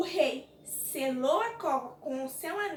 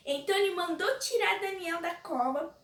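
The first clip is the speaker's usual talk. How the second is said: normal talking voice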